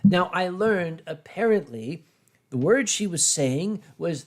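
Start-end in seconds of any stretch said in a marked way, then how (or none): none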